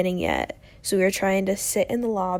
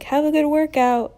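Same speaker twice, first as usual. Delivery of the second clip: higher, louder starstruck farewell; eager call-out; 7 semitones higher, 5 dB louder than usual. Retold or imitated. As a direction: call after him, giddy and eager. Calling after passing celebrity.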